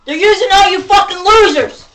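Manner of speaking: raging yell; furious shout at them; angry shout. Real, not acted. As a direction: bellow the insult. Angry rant at people.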